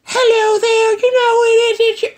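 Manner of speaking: very high, thin squeak; abandoned tiny caller voice; squeaky high voice. Acted. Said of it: "I can't run it like that".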